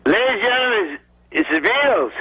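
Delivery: deliberately lame Sullivan intro; bad Ed Sullivan impression. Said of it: "he goes".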